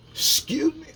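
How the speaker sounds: high startled "Excuse me?"; mock surprise. Answering the gossiper's insult, high and taken aback. Reacting to accusation.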